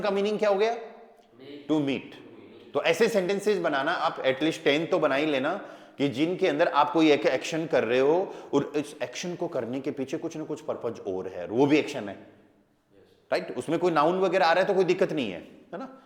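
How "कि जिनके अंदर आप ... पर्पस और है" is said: ordinary delivery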